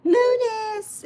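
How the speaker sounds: high, far-off call of a name; shouted greeting. Retold or imitated. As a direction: call the name out across the room. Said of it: "and they shout".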